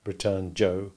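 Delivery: usual speaking voice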